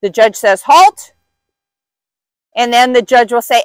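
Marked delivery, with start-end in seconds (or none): 0.6-1.1 loud, high call of halt; called-out command. Retold or imitated. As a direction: call it across the ring. Judge calling out the halt.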